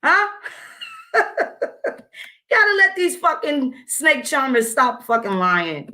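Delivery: normal talking voice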